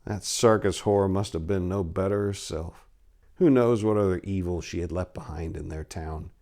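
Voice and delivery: ordinary delivery, nothing marked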